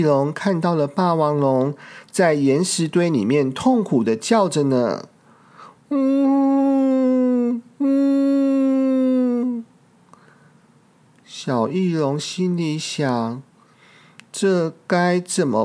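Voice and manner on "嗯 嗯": high, drawn hum of pain; two high groaning hums; high pained moan. Acted. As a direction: moan like a hurt dinosaur. Injured T. rex moaning.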